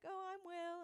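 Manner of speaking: imitated introduction, pitched well up; high-pitched introduction. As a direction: mimic a polite first introduction in a high voice. Acting out awkward introductions.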